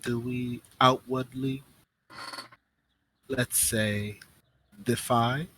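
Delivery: lightly voiced deliberate schemer, measured pauses; a hint of an in-character strategist weighing options; composed woman plotting. Woman asks Tanari whether to defy or play along, lightly voiced deliberation. Game master plays the woman.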